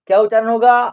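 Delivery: ordinary talk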